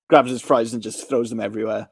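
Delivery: ordinary delivery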